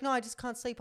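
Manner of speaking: lightly voiced flustered excuse; nervous excuse. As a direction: protest too quickly, caught out. Quoting him in excuse.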